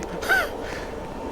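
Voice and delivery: soft, breathy high laugh; amused little laugh at own accent; quiet airy chuckle. Real, not acted.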